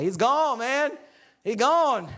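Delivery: high, playful report of a vanishing; mock dismay. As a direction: exclaim it like a comically resigned buddy. Acting friends reporting he vanished.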